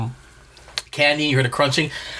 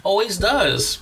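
ordinary talk

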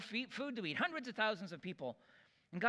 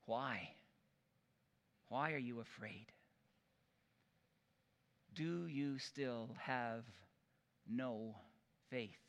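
lower, soft, slow question; gentle Jesus; 5 semitones lower, 5 dB quieter, slower than usual. Retold or imitated. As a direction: ask them tenderly, no rebuke. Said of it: "Then he asked them".

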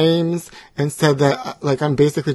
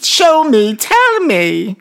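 higher, louder put-on voice; high-voiced job seeker; 9 semitones higher, 5 dB louder than usual. Ex-talk-show guest asking a fast food worker for a job, high put-on voice.

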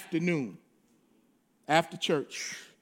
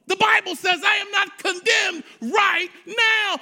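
fiery preaching; 8 semitones higher than usual. Acted. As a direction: preach it hard to the congregation. Preaching at full force.

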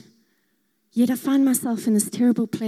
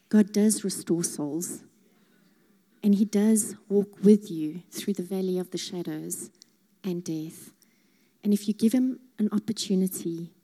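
ordinary talk

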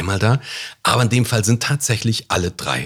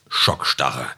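dramatic trailer voice; 9 semitones lower than usual. Acted. Dramatic title word in intro.

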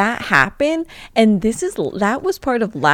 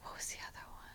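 whisper; 30 dB quieter, much faster than usual. Real, not acted. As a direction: mutter while searching memory. Whispering while recalling.

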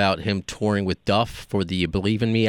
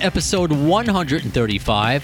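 podcast announcer. Acted. Produced intro read over music.

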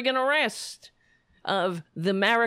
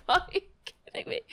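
laughing disbelief; 8 semitones higher, 3 dB quieter, faster than usual. Real, not acted. Laughs at absurd comment.

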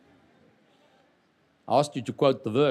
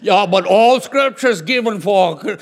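loud, higher mock protest; nervous objectors; 5 semitones higher, 9 dB louder than usual. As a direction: blurt it out as a hammed-up objector. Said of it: "I can tell people are nervous".

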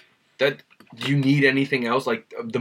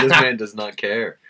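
ordinary talk

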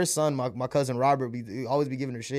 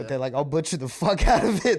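ordinary talk, nothing marked

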